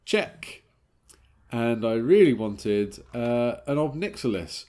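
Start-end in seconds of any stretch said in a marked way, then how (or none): 0.1-0.6 high, pleased check; triumphant check. Real, not acted. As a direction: tick it off with a jump up. Ticking off wanted cards.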